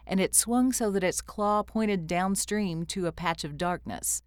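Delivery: ordinary delivery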